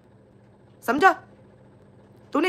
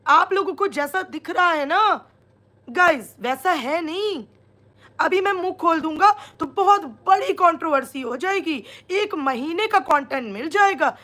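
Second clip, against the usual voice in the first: mock imitation; 4 semitones higher than usual. Vlogger quoting his live hints to the audience, mockingly. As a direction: mimic him in a raised, stretched-out voice. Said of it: "indirect वे में सबको बोल रहा था".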